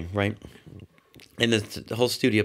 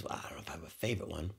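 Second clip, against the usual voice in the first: much softer, quick, offhand; quiet reply; 4 semitones lower, 15 dB quieter, much faster than usual. Retold or imitated. Imitating Roger answering softly and quickly. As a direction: mumble it modestly, fast.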